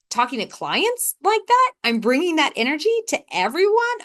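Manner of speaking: high, incredulous, stretched-out delivery; mock horror. Speaker imagining bringing bad energy to clients, mock horror.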